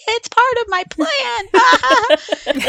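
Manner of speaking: put-on very high, slow, squealing kid's voice; giggly child voice. Mom imitates her giggling daughter's high squeal. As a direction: squeal it out like a tickled little girl. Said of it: "She's like".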